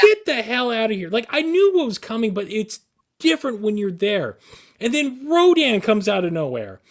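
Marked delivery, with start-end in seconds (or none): 0.0-1.1 higher, louder mock yell of fright; excited yell. Riding the Godzilla ride, a joking half-yell. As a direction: yelp it like a kid grabbed by a monster.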